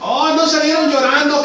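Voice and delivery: ordinary talk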